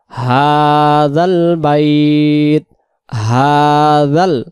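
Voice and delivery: হাযাল with an open-throat হা stretched one alif; slow হাযাল, হা held long. Shown as a demonstration. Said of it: "হা গলা ছেড়ে এবং এক আলিফকে আমরা টান দেবো".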